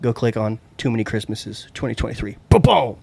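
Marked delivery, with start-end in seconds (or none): none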